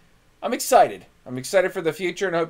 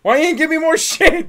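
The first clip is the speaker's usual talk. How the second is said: higher, louder outraged put-on; mock bird voice; 11 semitones higher, 5 dB louder than usual. Two friends voicing the dead bird, outraged and high. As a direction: complain like a snubbed pet. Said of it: "Could you give me a bit more?".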